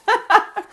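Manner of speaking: a loud, high, delighted burst of laughter. Real, not acted. Delighted at her own story.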